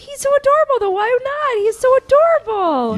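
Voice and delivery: very high, loud mock squeal; gushing. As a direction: imitate a woman cooing loudly over someone cute. Imitates seller gushing over him.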